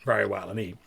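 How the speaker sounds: ordinary talk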